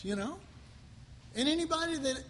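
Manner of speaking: normal talking voice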